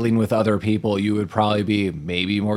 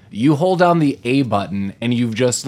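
ordinary delivery

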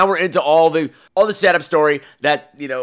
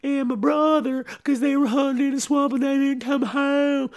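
put-on a scared girl pleading for help, pitched high; high girlish voice; 11 semitones higher, 3 dB quieter than usual. Girl asks fisherman for help in high girlish voice. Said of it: "She's like".

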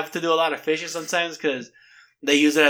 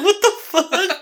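high, laughing mock shock; William's reaction; 4 dB louder than usual. Imagining William seeing the bear punch fish, laughing and high. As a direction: giggle out the outrage. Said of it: "he'd be like".

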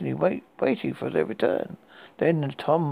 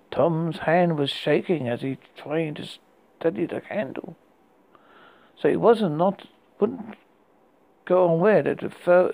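ordinary delivery

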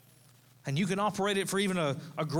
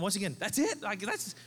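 relieved disbelief; 9 semitones higher than usual. Retold or imitated. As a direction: exclaim like a weight just lifted. Relieved reaction to pregnancy news.

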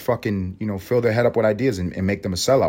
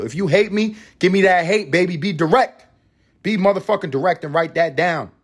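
hyped challenge; 7 semitones higher than usual. Acted.

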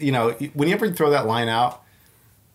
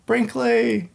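imitating a man calling a dog's name, higher; 12 semitones higher, much slower than usual. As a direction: call the name out across the park. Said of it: "he starts calling".

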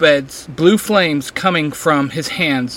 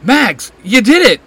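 high, louder happy cry; beaming with relief; a bright smiling exclamation; smiling joy; 7 semitones higher, 6 dB louder than usual. A narrator's reading.

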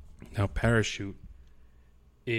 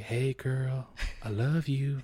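higher, sweet mock-smitten voice; lovestruck pickup line; 5 semitones higher, slower than usual. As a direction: play the smitten suitor. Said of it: "I was like".

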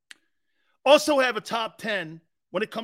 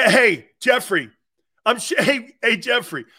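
calling out; 2 semitones higher, 7 dB louder than usual. Real, not acted. Calling out to Jeffrey.

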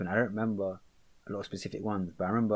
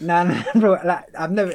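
laughing; 7 semitones higher, 9 dB louder than usual. Real, not acted. Laughing while telling.

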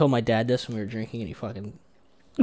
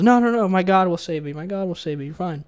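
high, loud opening wave-off; acted drowning man brushing off help; insistent refusal; 7 semitones higher, 5 dB louder than usual. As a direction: play stubborn faith, pitched up. Joke: rabbi refuses second rescuer.

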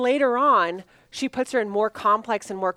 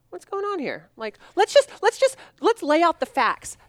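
high-pitched; 8 semitones higher, 3 dB louder than usual. Retold or imitated. Calming voice in retold scene.

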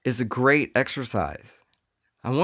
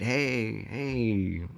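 soft, drawn-out come-on; smooth flirty hey; 6 dB quieter than usual.

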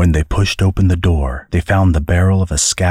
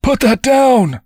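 high-pitched panicked shout; alarmed cry; 4 dB louder than usual. A narrator's reading. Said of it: "cried the man".

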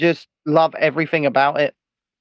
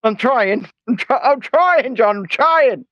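strained; 8 semitones higher, slower than usual. Real, not acted. Straining to wink, high pitched.

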